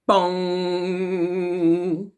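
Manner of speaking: voiced bong like a big clock bell. A narrator's reading. Narrator voicing Big Ben striking, bell bong.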